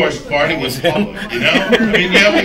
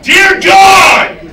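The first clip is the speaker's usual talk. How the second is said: loud, high cry of frustration; wife bursting out at God over the parking; mock wife yelling at the sky; exasperated shout; 10 dB louder than usual. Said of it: "my wife goes".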